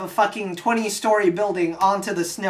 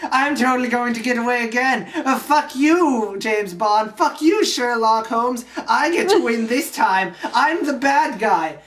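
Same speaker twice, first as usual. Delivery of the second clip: gloating villain; 4 semitones higher, 3 dB louder than usual. Retold or imitated. Moriarty taunting his pursuers as he escapes, gloating. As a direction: crow like a villain getting away.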